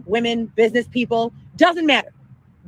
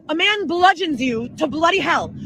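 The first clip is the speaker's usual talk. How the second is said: ordinary talk, nothing marked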